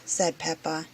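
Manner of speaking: usual speaking voice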